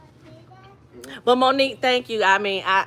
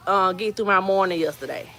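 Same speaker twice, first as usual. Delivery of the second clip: usual speaking voice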